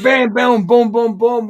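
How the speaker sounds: high, loud string of hits; shouted impacts. Acted. Wrestler acting out the match spots, loud punchy impacts. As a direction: rattle off the blows at full voice.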